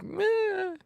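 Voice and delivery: high, drawn-out bleat of a meh; high-pitched meh. Retold or imitated. Imagining skating the rest of the park, high bleated meh. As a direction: squeak out a long, high "meh". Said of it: "and you're like".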